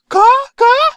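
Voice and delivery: loud, high two-note squawk; crow caws. Acted. The two speaking crow back and forth, cawing. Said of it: "they're just speaking crow".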